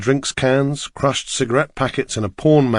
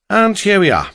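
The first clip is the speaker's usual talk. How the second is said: higher, lilting Welsh cabbie; Welsh accent; 8 semitones higher, 4 dB louder than usual. A narrator's reading. Driver tells passenger they have arrived, higher Welsh accent. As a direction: announce arrival in a Welsh lilt. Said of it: "his strong Welsh accent".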